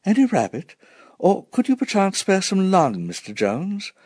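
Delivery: high, polite request; a lady asking very politely; genteel mother asking. A narrator's reading.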